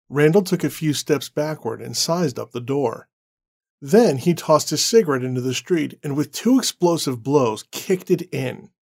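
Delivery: ordinary talk, nothing marked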